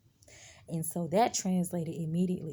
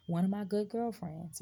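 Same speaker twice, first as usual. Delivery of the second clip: normal talking voice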